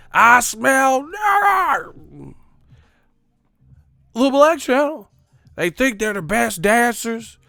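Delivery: high, loud, drawn-out bigot caricature; Clayton Bigsby. Retold or imitated. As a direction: holler it, stretching the last words. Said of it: "imagine them saying it in the Clayton Bigsby".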